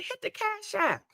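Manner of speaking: high, soft pretend-struggling plea; mock-feeble plea. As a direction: beg weakly for donations, as a joke. Jokey plea for donations while struggling.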